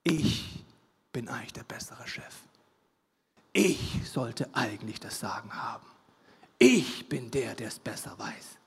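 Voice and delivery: low, whispered voice of a rebellious inner spirit; whispered voice of the inner rebel; whispering spirit of rebellion. Acted. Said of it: "der sagt".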